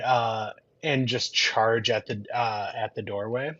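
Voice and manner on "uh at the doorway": ordinary talk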